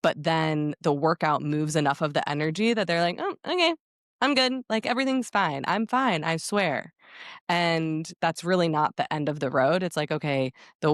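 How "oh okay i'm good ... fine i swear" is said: high-pitched put-on "all fine" voice; high mock reassurance, easing down at the end; mock insistence. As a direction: imitate someone brightly brushing it off, pitched way up. Voicing men claiming they are fine.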